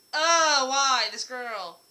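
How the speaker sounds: loud, high mock exasperation; exasperated. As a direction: throw up your hands at her. Voicing Faust's exasperation with Quest.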